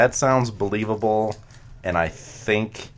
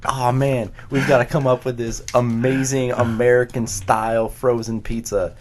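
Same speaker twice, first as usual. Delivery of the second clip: sketch character; 5 semitones higher, 6 dB louder than usual. Retold or imitated. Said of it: "that sketch where it's like".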